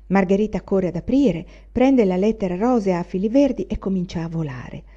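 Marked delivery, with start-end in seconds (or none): none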